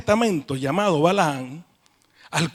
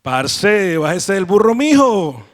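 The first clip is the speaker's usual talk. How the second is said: put-on louder, playful Colombian accent; a Colombian paisa calling out, louder; 5 dB louder, slower than usual. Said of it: "Y si fuera colombiano".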